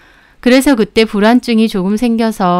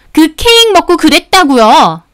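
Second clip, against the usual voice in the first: high, loud, furious outburst; crazed yelling; 7 semitones higher, 5 dB louder than usual. Acted. Customer cutting off the shop owner, yelling wildly. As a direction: cut in yelling like a madwoman. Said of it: "미친 사람처럼 소리를 지르는데".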